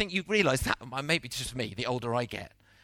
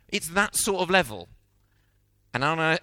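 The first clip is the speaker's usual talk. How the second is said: ordinary delivery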